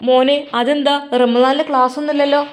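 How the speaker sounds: surprised mother. Acted. Mother surprised son leaving.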